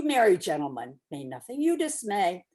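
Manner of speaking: usual speaking voice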